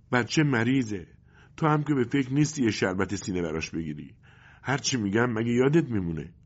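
nagging mother. Acted. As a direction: press him like a fed-up wife. Mother reproaches father. (مادری غرغرو با صدای بلندتر و زیرتر)

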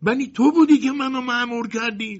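very high, loud, stuttering squeak; a terrified mouse stammering its defence. Acted. The mouse stammers to the colonel before the hammer falls, squeaking high and loud. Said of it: "با لکنت گفت".

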